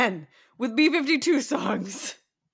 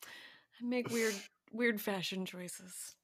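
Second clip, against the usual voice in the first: slow, quiet, dry confession; soft deadpan; 9 dB quieter than usual. Real, not acted. Co-host confesses what the song inspired, soft deadpan. Deadpan answer to co-host's question.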